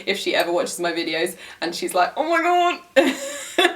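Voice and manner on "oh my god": high, drawn-out mock horror; slow, hammed-up cringing groan with a laugh; imagined cringe. Imagined viewer hearing a bad accent, mock cringing. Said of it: "it makes her cringe".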